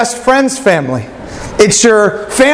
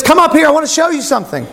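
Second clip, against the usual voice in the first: retold calling out; 8 semitones higher, faster than usual. Man on the cliff to rafter, mock calling out. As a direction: call them up to you. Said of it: "the man that's been yelling at you".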